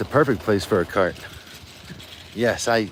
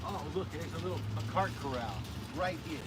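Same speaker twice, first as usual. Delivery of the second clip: high, soft hushed voice; wildlife watching whisper; 5 semitones higher, 14 dB quieter, faster than usual. Pretend. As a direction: hush like spotting an animal. Nature watching hush.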